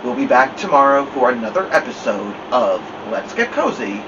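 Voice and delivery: ordinary delivery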